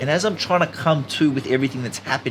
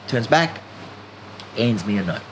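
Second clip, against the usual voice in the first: ordinary talk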